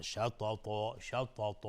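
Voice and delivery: "شططا" with heavy, dark t's; thick ta. Shown as a demonstration. Teacher demonstrates istila, "شططا" said thick. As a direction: round "شططا" toward shatoto. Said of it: "یعنی غلیظ باید باشه".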